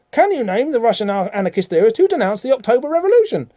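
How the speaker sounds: high-pitched, hammed-up teacher rant; mock telling-off shout. Teacher catches Dane not paying attention, mock shouted telling-off. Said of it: "starts shouting and telling him off".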